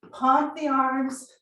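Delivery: upbeat pumped-up workout cues; aerobics instructor. Acted. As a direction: cheer them through the last seconds. Coaching live cardio set.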